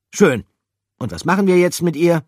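high, loud question; character voice. Acted. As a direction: ask what to do. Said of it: "fragte Pikandu".

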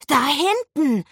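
put-on high, excited child's cry; excited call. A narrator's reading. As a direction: call out a sudden sighting, pitched high. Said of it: "rief er aufgeregt". (aufgeregt gerufen)

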